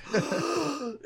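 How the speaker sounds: sharp high theatrical gasp; mock dramatic gasp. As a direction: gasp hugely before a long talk. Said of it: "where he just goes".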